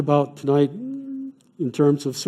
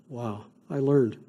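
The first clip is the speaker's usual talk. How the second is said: normal talking voice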